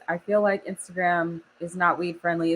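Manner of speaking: ordinary talk, nothing marked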